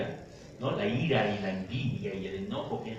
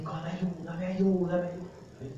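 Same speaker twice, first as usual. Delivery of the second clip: mock pleading cry, higher, fading at the end; retold cry for help; 6 semitones higher, 4 dB quieter, much slower than usual. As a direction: imitate a scared boy calling for help, lightly. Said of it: "el grito de". (grito de auxilio imitado, agudo)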